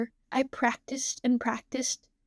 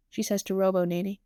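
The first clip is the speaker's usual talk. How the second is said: usual speaking voice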